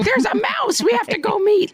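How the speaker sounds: very high squealed urgency; pretend-scream like an over-eager theme park parent; mock screaming parent. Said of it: "I'm not gonna be screaming".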